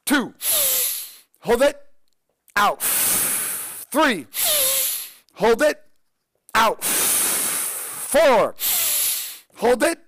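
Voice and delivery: loud, high breath count with big held breaths. Shown as a demonstration. Coach leading viewers through five breaths, loud and high. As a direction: call out each count like a coach leading a drill. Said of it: "We're gonna breathe in five times".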